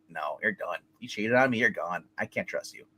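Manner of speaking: low, quiet finality; hushed, low mock breakup; imagined cold dismissal. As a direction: play at ending it, voice dropped right down. Imagined firm breakup with cheating wife.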